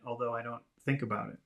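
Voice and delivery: normal talking voice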